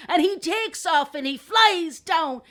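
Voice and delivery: narration building high and loud; animated storyteller. A narrator's reading. Telling the last crow's flight, rising excitement. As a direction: build suspense toward a cliffhanger.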